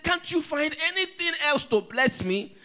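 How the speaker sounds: high, loud plea; desperate pleading. Acted. Esau begging his father for any blessing, desperate pleading. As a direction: beg urgently, pitch held high.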